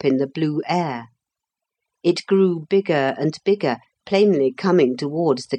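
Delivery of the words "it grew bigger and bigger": ordinary delivery, nothing marked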